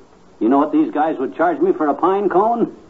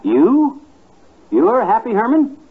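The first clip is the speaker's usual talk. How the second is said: surprised; 7 semitones higher, slower than usual. Real, not acted. McGee to gloomy Herman, startled and disbelieving. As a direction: caught off guard, blurt it out.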